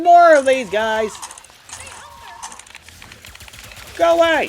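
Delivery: frantic yelling at enemies; panicked shout. Real, not acted. Swarmed by enemies in game.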